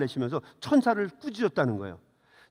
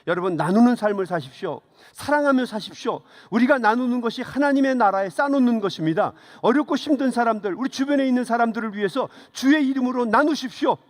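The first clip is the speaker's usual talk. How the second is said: higher, louder preaching voice; raised, earnest sermon delivery; retold exhortation; 6 semitones higher, 5 dB louder than usual. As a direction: urge the congregation like an elder giving testimony. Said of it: "간증을 하시면서 사람들에게 말씀하셨어요".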